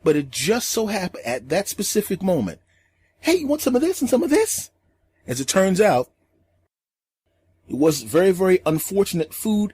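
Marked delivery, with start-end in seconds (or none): 3.2-4.7 put-on very high, eager kid chatter; little kid voice. The boy greets everyone while offering food, very high and eager. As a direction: bounce it up high like an excited kid.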